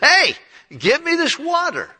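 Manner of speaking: high, loud, eager plea for the water; eager woman's plea. Retold or imitated. Samaritan woman begging Jesus for the water, high and eager. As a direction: sound like she's finally sold on it.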